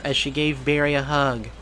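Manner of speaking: plain reading voice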